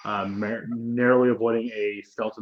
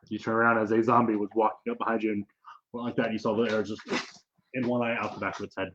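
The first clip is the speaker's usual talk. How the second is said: ordinary talk, nothing marked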